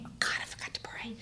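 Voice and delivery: whispered, sheepish, quick; a guilty whisper as if caught forgetting; mock whisper. Mocking herself told to pray.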